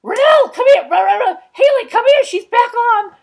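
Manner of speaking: hammed-up impression of a relative yelling across the house; imitated shrill holler. Imitating a household member yelling for Rennell and Haley, mock hollering. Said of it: "Rosella Dwarf going".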